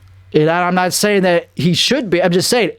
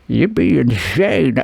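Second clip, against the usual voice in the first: Heath Ledger impression; 3 semitones lower, slower than usual. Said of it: "he'd be like".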